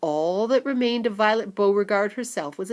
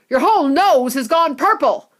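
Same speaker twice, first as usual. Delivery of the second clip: shouting; 7 semitones higher, 8 dB louder than usual. A narrator's reading. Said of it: "shouted Mister Beauregard".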